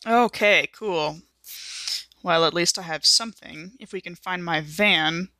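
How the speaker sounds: ordinary talk, nothing marked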